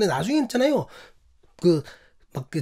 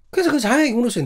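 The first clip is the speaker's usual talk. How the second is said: usual speaking voice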